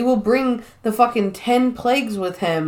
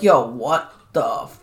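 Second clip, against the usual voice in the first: low slow dude voice; 7 semitones lower, slower than usual. Retold or imitated. Americans voiced in retelling.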